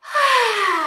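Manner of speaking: high, loud breathing-out sigh; big sigh of release. Shown as a demonstration. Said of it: "breathe into your heart".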